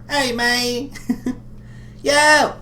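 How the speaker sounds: high excited imitation voice; high nasal-free hyped comic voice; Aziz Ansari impression. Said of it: "say it like Aziz Ansari".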